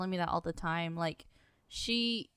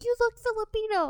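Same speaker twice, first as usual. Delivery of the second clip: high, bright mock auntie voice, much higher; imitating Filipino mom; 13 semitones higher, 5 dB louder than usual. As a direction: play a curious older mom, pitched up. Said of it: "Filipino moms that come up to me like".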